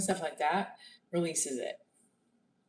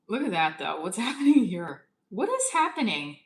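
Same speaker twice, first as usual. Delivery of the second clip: surprised; 6 semitones higher, 7 dB louder than usual. Real, not acted. Startled by something on screen.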